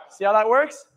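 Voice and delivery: usual speaking voice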